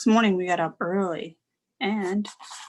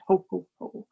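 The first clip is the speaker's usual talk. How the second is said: Santa laugh. A narrator's reading. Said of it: "Santa's elf called".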